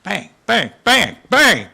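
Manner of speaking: loud, punchy bang bang imitation; mock gunfire, sharp and loud; gunshot noises. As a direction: fire off the bangs like a rifle. Imitating gunfire in war story.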